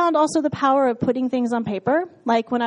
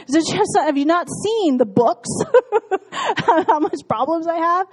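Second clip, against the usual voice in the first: laughing disbelief; 5 semitones higher, 4 dB louder than usual. Real, not acted. Laughing incredulous to God.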